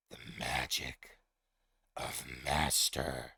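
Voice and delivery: very low, slow beast voice; deep monster voice. Acted. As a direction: ancient creature, slow and heavy. DM voices the Manticore speaking.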